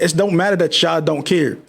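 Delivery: ordinary talk, nothing marked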